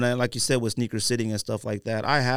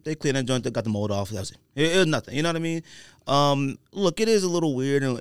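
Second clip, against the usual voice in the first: normal talking voice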